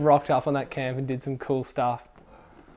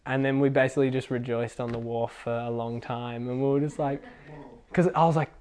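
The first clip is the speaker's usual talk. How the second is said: usual speaking voice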